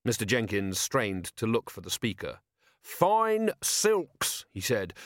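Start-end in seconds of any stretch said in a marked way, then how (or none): 2.8-4.5 high-pitched public declaration; insistent proclamation. A narrator's reading. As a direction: insist on it in a high orator's cry. Merchant insists before the crowd.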